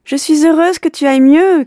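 high, loud happy call upward; girl calling up cheerfully to the window; glad shout. Acted. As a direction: shout up to the boy, delighted. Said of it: "cria Yaroslava".

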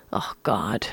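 whispered groan under the breath; barely voiced, despairing mutter; a whispered oh God of dismay. A narrator's reading. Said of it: "muttered Zaphod".

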